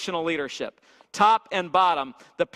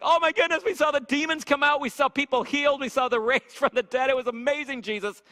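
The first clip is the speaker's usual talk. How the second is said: much higher, gushing with wonder; excited disciples; 7 semitones higher than usual. Retold or imitated. Disciples back from a mission tell Jesus, gushing and high. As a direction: bubble over like thrilled disciples.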